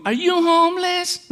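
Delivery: put-on high, childlike question; high little-boy voice asking innocently; little son's voice. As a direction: ask it like a curious small boy. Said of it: "le pregunta el hijo mío".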